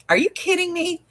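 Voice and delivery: very high, squeaky disbelief; retold incredulity. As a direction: ask in mock astonishment. Retold disbelief at agent's explanation.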